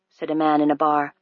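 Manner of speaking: ordinary delivery, nothing marked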